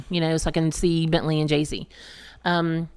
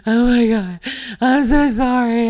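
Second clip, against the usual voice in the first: retold crying apology; 6 semitones higher, 7 dB louder than usual. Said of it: "I start crying".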